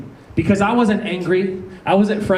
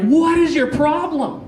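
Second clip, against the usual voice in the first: high-pitched, exasperated demanding; worked-up retold quarrel voice; acted angry confrontation; 11 semitones higher than usual. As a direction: demand answers in hammed-up outrage. Acted confrontation at the car window.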